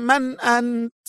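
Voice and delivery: terrified gasp of a question; choked voice. A narrator's reading. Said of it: "بصوت مختنق".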